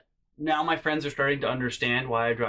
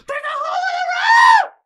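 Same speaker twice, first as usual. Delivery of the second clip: very high, loud screaming mom; panicked shriek; 14 dB louder than usual. Retold or imitated. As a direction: scream it like mom grabbing the wheel. Mom shrieking in passenger seat.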